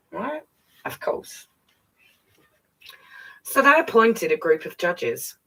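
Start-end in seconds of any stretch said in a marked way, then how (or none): 0.1-1.5 unvoiced little aside; whispered aside. Real, not acted. Mum reading aloud, whispering an aside. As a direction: whisper it knowingly.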